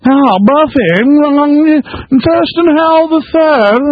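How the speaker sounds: put-on very high, slow East Coast accent caricature; mock Bush East Atlantic accent. Host mocking him up north, exaggerated East Atlantic accent. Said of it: "he'll shift into the East, uh, Atlantic accent".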